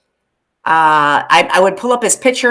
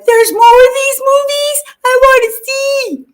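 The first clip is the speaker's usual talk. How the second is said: much higher put-on excited kid voice; mock little sister; 4 dB louder than usual. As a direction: squeak it like the thrilled sister. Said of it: "And then she's like".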